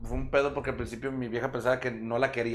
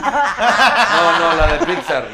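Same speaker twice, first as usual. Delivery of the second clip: louder laughing correction; 4 semitones higher, 14 dB louder, much slower than usual. Real, not acted. Laughing as he corrects friends who misheard. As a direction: laugh while setting the record straight.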